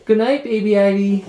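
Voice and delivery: high tender good night; pillow talk. Real, not acted. Saying good night to baby.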